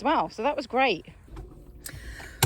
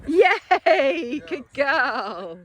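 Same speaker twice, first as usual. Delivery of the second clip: excited praise; 2 semitones higher, 4 dB louder, much slower than usual. Real, not acted. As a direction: cheer the dog on, stretching it out. Praising a dog after recall.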